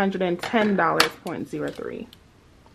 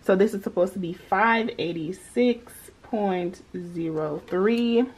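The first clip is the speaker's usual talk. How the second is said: ordinary talk, nothing marked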